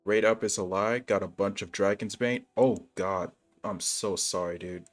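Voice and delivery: ordinary talk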